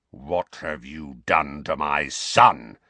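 low, slow threatening growl; menacing growl. Acted. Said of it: "he said in a menacing growl".

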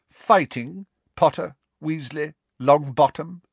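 high, deliberate sneer; cold, sneering. A narrator's reading. Snape catching students fighting at his door, sneering. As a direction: sneer each name coldly. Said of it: "said in his cold, sneering voice".

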